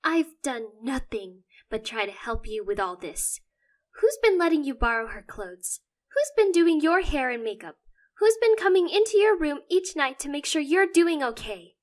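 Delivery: loud, climbing frustrated questions; frustrated outburst. Acted. Sister venting frustration at her brother, loud. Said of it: "Phoebe goes bright red in frustration".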